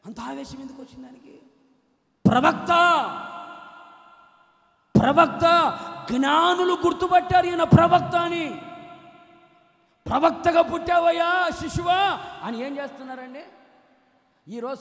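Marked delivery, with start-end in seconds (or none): 2.3-12.2 loud, high preacher's shout; full-force sermon cry; fiery preaching. Acted. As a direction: thunder it from the pulpit.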